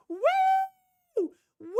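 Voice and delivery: long, drawn-out mock "woo" cheer; imitated cheering woo. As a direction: whoop randomly like a drunk spectator. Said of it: "Just, like, cheering randomly".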